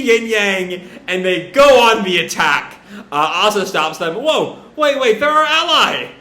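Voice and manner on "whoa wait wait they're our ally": high-pitched urgent plea to halt; urgent protest. Retold or imitated. Asa stopping allies mid-attack, urgent high protest. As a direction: cut in to stop the attack, pitch jumping up.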